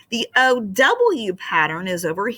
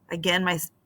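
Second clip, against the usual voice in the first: ordinary delivery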